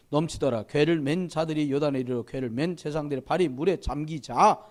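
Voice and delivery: measured formal reading of scripture. Acted. Pastor reading Joshua to the congregation, formal reading voice. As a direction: read the passage out steadily to the congregation.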